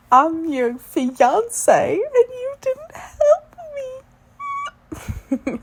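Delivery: hammed-up wail, drawn out and climbing very high; dramatic. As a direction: play the wronged fiancée with slow, mock-tearful whining. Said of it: "I'm being dramatic now".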